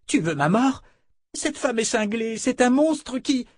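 put-on high, frightened young man; panicked protest. A narrator's reading. Terrified Fitch protests, is cut off.

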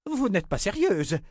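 high-pitched indignant outburst. A narrator's reading. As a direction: explode in protest. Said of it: "explosa Ornetta". (explosion indignée, voix haut perchée)

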